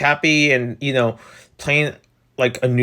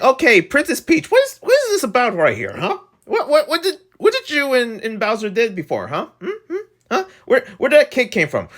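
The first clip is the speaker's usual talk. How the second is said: high-pitched, incredulous grilling; mock interrogation; 9 semitones higher than usual. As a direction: play-act pressing a suspect for answers. Acted grilling of Princess Peach.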